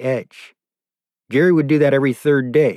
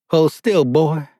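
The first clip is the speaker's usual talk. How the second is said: higher, firm order to hold still; commanding; 5 semitones higher, 3 dB louder, slower than usual. A narrator's reading. Captor locking a collar on his prisoner, commanding. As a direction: order them like a captor in control. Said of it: "he commanded".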